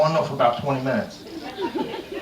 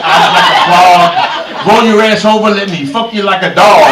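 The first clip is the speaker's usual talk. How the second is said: loud recital; 3 semitones higher, 11 dB louder than usual. Retold or imitated. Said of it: "I said".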